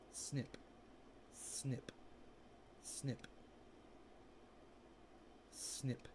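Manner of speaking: soft snip. Acted. Trimming leaves on camera, soft whispered snips. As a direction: whisper each snip as you cut.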